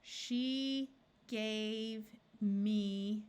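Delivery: very slow, word by word; slow reading. Shown as a demonstration. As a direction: read it out slowly for a learner. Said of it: "reading slowly, sentence practice".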